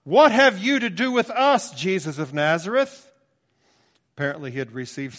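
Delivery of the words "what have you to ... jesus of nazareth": loud, high shriek of a demon; demon crying out. Acted. Preacher voicing the demon crying out loudly. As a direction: cry out in alarm at Jesus. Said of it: "he cried out with a loud voice".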